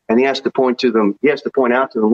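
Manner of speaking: normal talking voice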